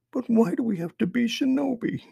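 put-on very high, thin child's voice; young boy. A narrator's reading. Son questioning his mother about being shinobi, boyish pleading.

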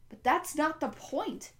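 loud, slow and forceful. A narrator's reading. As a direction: say it loud enough to make them jump. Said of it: "so loud she made both Connor and Miss Kwan jump".

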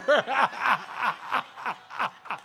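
long run of real chuckles; real laughter. Real, not acted. Preacher amused by the bathroom sign prank, laughing hard. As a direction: crack up at your own story. Laughs at his own funny story.

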